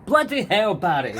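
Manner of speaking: loud, high teasing cry at Barry; mock outrage. Real, not acted. Host teasing his co-host, loud and high. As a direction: exclaim his name in jokey disbelief.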